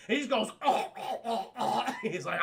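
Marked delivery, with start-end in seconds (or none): 0.6-1.8 repeated hammed-up choking gasps; mock choking. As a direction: gag as if stabbed in the throat. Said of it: "shoves it up his throat".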